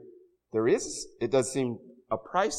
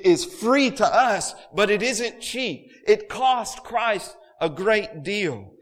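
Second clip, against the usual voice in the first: louder, higher, driving sermon cadence; full-force preaching; 6 semitones higher, 7 dB louder than usual. Acted. Pastor urging the congregation, preaching at full force. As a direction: preach the abundance with rising fervor.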